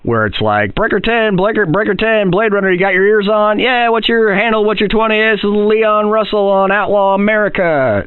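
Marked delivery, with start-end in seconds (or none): 0.7-3.6 CB radio call. Shown as a demonstration. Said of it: "like, a C B conversation". 3.6-8.0 high trucker radio chatter. Shown as a demonstration. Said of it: "like, a C B conversation".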